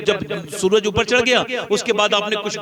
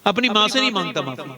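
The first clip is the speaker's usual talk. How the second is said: normal talking voice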